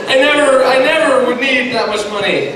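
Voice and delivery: loud, high flustered denial; panicked backpedalling. Acted. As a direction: protest your innocence to Mom in a hurry to appease. Acted backpedalling to his mother.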